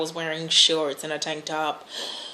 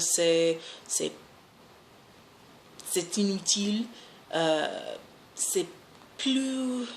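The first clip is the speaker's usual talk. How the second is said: halting French with an American accent; speaking French. Shown as a demonstration. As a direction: stumble through rusty French, searching for words. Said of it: "as far as speaking another language".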